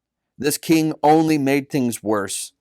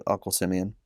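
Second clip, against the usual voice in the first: ordinary delivery, nothing marked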